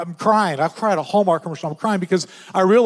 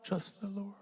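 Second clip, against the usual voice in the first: whispered verse; 2 semitones higher, 22 dB quieter than usual. Acted.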